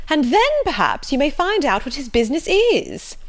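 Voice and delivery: high, loud, gleefully nosy; excited cry. A narrator's reading. Mrs Jennings hoping Sir John finds out the business, excited. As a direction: swoop up eagerly at the chance to pry. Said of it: "cried Missus Jennings".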